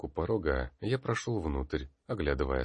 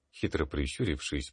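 usual speaking voice

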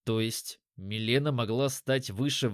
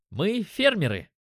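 proud, higher-pitched declaration; 6 semitones higher than usual. A narrator's reading. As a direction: announce your trade with a touch of pride. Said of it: "гордо сказал Тарион".

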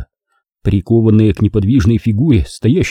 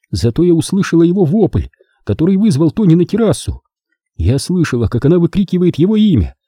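higher, bitter woman's confession; Naomi confessing; 7 semitones higher than usual. Acted. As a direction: tell it like a guilty woman unburdening herself.

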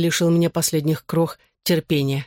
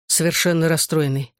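plain reading voice

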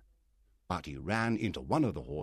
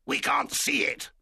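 pirate; 5 dB louder than usual. Acted. Pirate answers.

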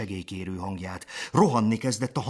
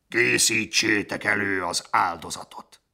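a louder barked order to prepare the sacrifice; commanding order; 5 dB louder than usual. A narrator's reading. Gnu leader ordering his herd to prepare the sacrifice, commanding. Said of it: "rendelkezett Kazár".

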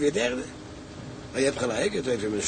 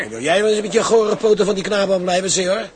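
louder, hard threat to the tout; angry warning; 4 semitones higher, 8 dB louder than usual. Acted. Bout warns the tout off the boy, angry and loud. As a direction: tell him off, ready to fight.

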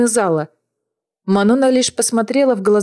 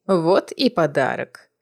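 grandmother voice; 4 semitones lower, 4 dB quieter, slower than usual. A narrator's reading. Grandmother presents prisoner.

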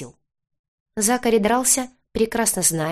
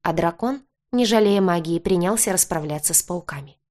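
ordinary delivery, nothing marked

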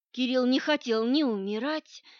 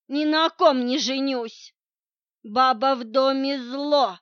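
put-on loud drunken rambling; 7 dB louder than usual. A narrator's reading. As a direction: shout like a drunk. Captive feigning drunk ramble.

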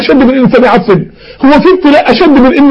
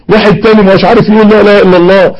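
usual speaking voice

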